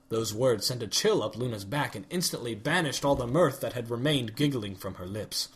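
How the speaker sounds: ordinary delivery, nothing marked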